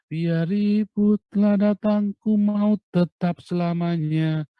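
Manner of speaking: high, loud and slow proclamation; drawn-out, loud declaration of faith; fervent rising call. Shown as a demonstration. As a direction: belt it out with conviction. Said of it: "rising in fervour".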